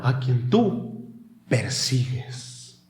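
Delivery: slow, hushed rebuke; soft voice of Jesus. Acted. Jesus speaking to Paul, slow and soft. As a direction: gently press the words.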